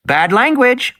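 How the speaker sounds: put-on a child telling off an adult, primly; scolding little girl. Little Lex scolding Ed Regis for swearing. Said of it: "Lex said, wagging her finger".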